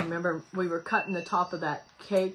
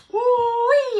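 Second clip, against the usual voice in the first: high, loud excited whoop; squealing woo-wee of joy; delighted whoop; 9 dB louder than usual. Real, not acted. Reacting to fizzy water taste.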